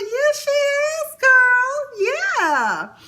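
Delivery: put-on high, slow sassy "Yeah, she is, girl"; sassy agreement. Vlogger hyping up the aunt with a high sassy agreement. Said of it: "I was like".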